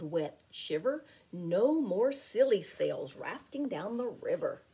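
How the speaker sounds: ordinary delivery, nothing marked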